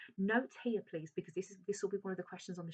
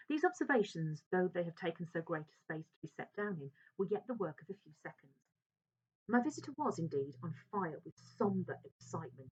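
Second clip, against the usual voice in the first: expressive literary reading aloud; dramatic reading of a Victorian novel; story reading; 4 dB louder than usual. A narrator's reading. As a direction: read the passage with drama. Teacher reads novel passage aloud.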